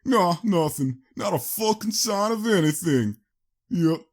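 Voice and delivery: high, loud rough raider voice; high, loud, scornful raider answer; raider Split Tooth. A narrator's reading. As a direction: sneer it out like a thug.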